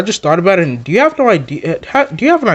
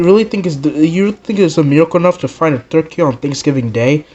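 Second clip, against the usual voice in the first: ordinary talk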